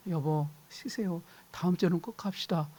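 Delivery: soothing a tired husband, softly; gentle. Acted. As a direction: coax kindly, no pressure. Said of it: "부드럽게 말을 될 걸".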